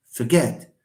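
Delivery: "forget" with weak fer, strong GET; low, reduced start to "forget", weight on GET; teacher's fer-GET for "forget"; stress on second syllable. Shown as a demonstration. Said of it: "Fer is just a, a weak one".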